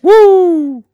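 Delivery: high loud woo; woo howl. Retold or imitated. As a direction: whoop it.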